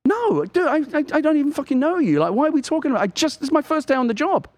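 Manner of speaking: high-pitched, indignant disbelief; incredulous refusal. Retold or imitated. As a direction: protest as if the offer were absurd. Acted refusal of murder offer.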